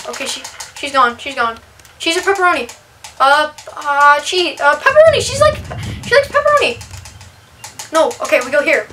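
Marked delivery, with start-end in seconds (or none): 4.6-6.8 high, loud relieved exclaim; triumphant at the right answer; excited. Real, not acted. Relieved guessing the right flavor.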